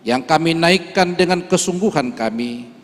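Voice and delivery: usual speaking voice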